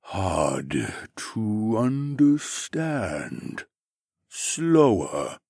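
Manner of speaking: very slow, drawn-out syllables; pensive slothman drone. A narrator's reading. Slothman explaining his brother, pensive slow drone. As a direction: labor through each word like a pensive sloth. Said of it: "he continued in a pensive tone".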